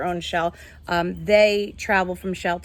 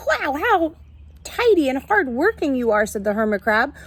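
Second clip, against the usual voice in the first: lively, higher storybook read-aloud; reading to children; 5 semitones higher, 4 dB louder than usual. A narrator's reading. Teacher reading a picture book to her class, lively storytelling.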